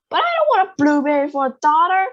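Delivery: loud fast yell. A narrator's reading. Father yells at Wonka in protest, loud and fast. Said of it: "yelled Mister Beauregard".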